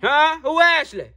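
high, loud questioning; yelling. Acted. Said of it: "can you stop yelling at me?".

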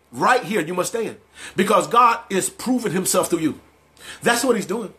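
usual speaking voice

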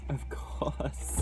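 exasperated whisper under the breath. Real, not acted. After the forks spill everywhere, an exasperated whisper. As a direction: breathe it out, fed up.

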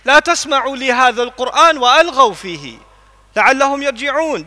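swift melodic Quranic recitation; fast chanted Arabic verse. Acted. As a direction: recite it in rapid tajweed flow.

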